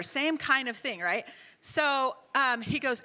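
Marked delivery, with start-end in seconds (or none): none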